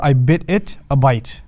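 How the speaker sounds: slow, spaced-out example sentence; deliberate demo phrase. Shown as a demonstration. Teacher rewording the English example, deliberate demo. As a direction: lay out "I bit it a bite" slowly. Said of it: "it'll sound something like this".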